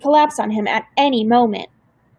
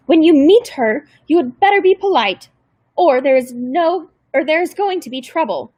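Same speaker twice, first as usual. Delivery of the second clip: raised, higher threatening warning; stern caseworker; 5 semitones higher, 4 dB louder than usual. A narrator's reading. Caseworker warning the girls before meeting grandmother, stern and raised. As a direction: wag a finger and lay down the law.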